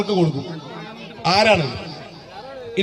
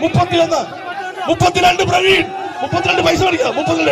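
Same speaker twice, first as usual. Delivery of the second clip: auctioneer; 5 semitones higher than usual. Acted. Auctioneer shouting final bids.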